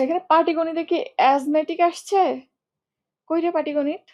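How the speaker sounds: usual speaking voice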